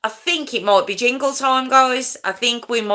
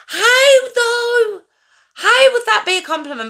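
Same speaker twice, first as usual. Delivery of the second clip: high, loud cry of disbelief; incredulous outcry; 14 semitones higher, 4 dB louder than usual. Real, not acted. Streamer disputing a backhanded compliment, high incredulous outcry. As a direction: shoot the pitch way up, indignant.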